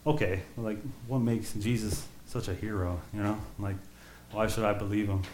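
quiet, soft doubting questions from an imagined listener. Pretend. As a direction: voice a skeptic wondering aloud, softly. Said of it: "you're like".

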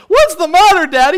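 high, loud mock kid voice; hammed-up child's question; concerned child. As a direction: pipe up loudly like an alarmed child. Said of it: "your kids are saying".

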